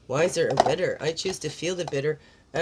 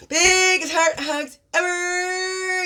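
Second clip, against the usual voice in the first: very high, loud, drawn-out squeal; effusive slow, high send-off; gushing hugs; 11 semitones higher, 6 dB louder, much slower than usual. Real, not acted. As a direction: squeeze out "ever" long and high. Sends exaggerated hugs to viewers.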